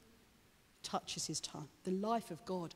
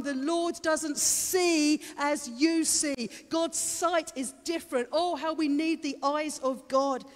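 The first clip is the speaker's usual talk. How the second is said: raised, ringing pitch, growing louder as it goes; impassioned preaching; 8 semitones higher, 6 dB louder than usual. Real, not acted. Preacher urging congregation to have eyes and ears opened, impassioned preaching. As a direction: drive the key words home like a revival preacher.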